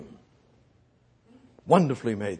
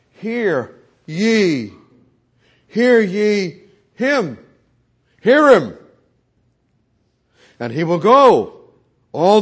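much louder and higher, slow and ringing; a preacher's full-force call to hear; booming proclamation; 6 semitones higher, 10 dB louder, slower than usual. Acted. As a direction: thunder it from the pulpit. Preacher booms God's command.

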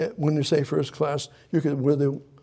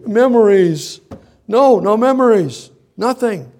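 impassioned grief; 4 semitones higher, 6 dB louder than usual. Acted. Preacher voicing Mary's grief.

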